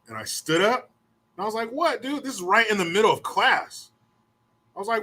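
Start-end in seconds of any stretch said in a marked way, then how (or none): 1.7-3.9 incredulous. Retold or imitated. Confrontation in class.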